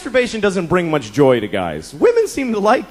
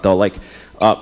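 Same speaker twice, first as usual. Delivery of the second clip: normal talking voice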